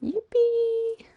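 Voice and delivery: high breathy whispered cheer; whispered yippee. Pretend. Echoing character's catchphrase.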